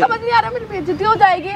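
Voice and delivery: shouting. Acted. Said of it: "हाँ, अब भी चिल्ला रही है।".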